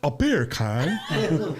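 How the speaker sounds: very slow British "A beer can?", laughing through it; mock English accent. Mocked by friends, a slow laughing English accent. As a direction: drag out a bad English accent. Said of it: "don't ever do an English accent".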